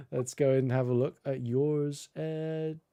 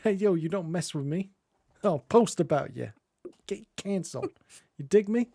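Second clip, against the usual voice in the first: higher-pitched swaggering street tough threatening someone; tough scout; 6 semitones higher than usual. Pretend. Streamer playing the scout, mock tough guy threat. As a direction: talk like a cocky scout picking a fight.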